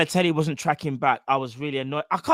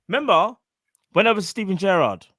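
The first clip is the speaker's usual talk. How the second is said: ordinary talk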